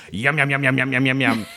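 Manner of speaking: greedy piggy munching sounds; pig eating impression. Shown as a demonstration. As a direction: gobble it up like a hungry pig. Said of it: "an impression of a pig eating".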